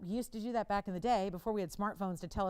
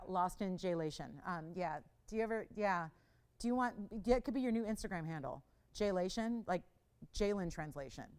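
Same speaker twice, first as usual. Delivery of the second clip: normal talking voice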